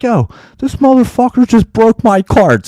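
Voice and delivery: plain reading voice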